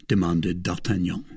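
usual speaking voice

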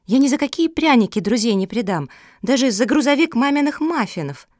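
high, loud indignant protest; defiant objection. A narrator's reading. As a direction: argue back with childlike conviction. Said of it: "решила поспорить Мафи".

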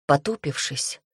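ordinary delivery, nothing marked

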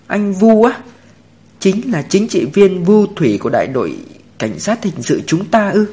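high-pitched, disbelieving question; incredulous surprise. A narrator's reading. Tuyết Toàn hears Vu is the expert, incredulous.

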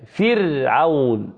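very slow "فرعون" with a thin, held ر after i; drawn-out, careful "فرعون" with light r. Shown as a demonstration. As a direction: stretch "فرعون" as fir-awn, r unvelarized. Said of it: "مكسورة".

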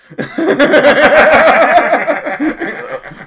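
long, high burst of laughter; loud laughter. Real, not acted. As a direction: crack up at the punchline. Laughing at own story.

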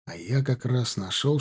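ordinary delivery, nothing marked

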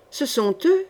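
understated, grim joy; deep funereal joy. A narrator's reading. Sorcerer recognising the three men, funereal joy. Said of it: "avec une joie profonde et funèbre".

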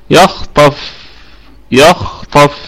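يخطف with a light ي and heavy خ ط; careful demo. Shown as a demonstration. Teacher modelling the word for the class. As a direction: say يخطف keeping the ي thin. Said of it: "ونراعي كمان ترقيق الياء".

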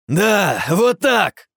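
high, loud gleeful yell; joyful shout. Acted. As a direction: cheer him on with delight. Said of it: "радостно выкрикнул я".

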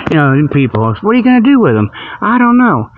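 usual speaking voice

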